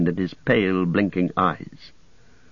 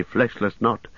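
usual speaking voice

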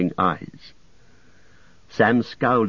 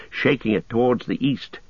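plain reading voice

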